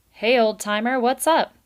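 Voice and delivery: loud, high breezy beaver; a pushy glad-hander; brash beaver. A narrator's reading. Beaver greets old Valerian, loud and breezy.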